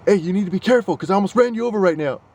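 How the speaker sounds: firm fatherly warning; stern dad voice. Retold or imitated. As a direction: scold like somebody's dad. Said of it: "turned into somebody's dad".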